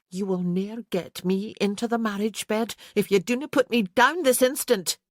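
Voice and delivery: a warning he should not ignore; angry wife demanding to be put down; Isabel, stern. A narrator's reading. Isabel scolds Kerr.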